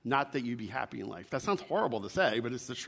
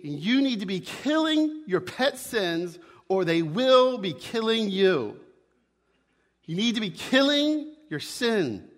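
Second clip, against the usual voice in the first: raised, high-pitched, hammered-out sermon warning; emphatic preaching; 5 semitones higher, 5 dB louder than usual. Real, not acted. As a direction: drive each phrase home to the congregation. Preacher drives home the warning.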